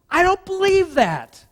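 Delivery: high, loud, slow mock disbelief; sarcastic disbelief. Preacher scoffing at the congregation, high and loud.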